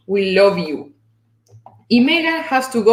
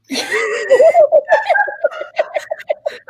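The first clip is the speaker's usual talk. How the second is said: a big delighted laugh; loud high laugh; 3 dB louder than usual. Real, not acted. Host laughing at her grumpy friend, loud high laugh.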